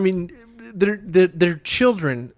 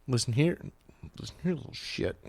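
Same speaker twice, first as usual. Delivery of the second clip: mock muttered threat; 3 semitones lower, 12 dB quieter, faster than usual.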